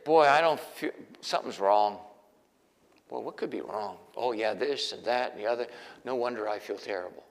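low, soft grumbling to himself, lightly voiced; a hint of gloomy self-talk. David's imagined gloomy self-talk, lightly voiced and low. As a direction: mutter like someone feeling sorry for himself. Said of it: "he didn't wake up and say".

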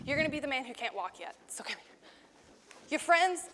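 ordinary talk

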